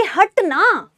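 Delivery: a little angry, shoo her away; teacher playing mild anger at a girl blocking the way; mildly annoyed. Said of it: "थोड़ी सी आपको गुस्सा आएगा".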